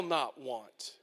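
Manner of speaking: low, slow and hushed; a low, slow, hushed vow; quiet. Acted. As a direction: drop it to a near murmur. Quiet take then shouted repeat.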